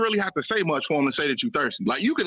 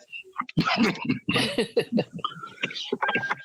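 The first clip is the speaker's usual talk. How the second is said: breathy high-pitched cackle; helpless wheezy laugh; high wheezing laugh; 13 semitones higher, 3 dB quieter than usual. Real, not acted. Laughing at own joke.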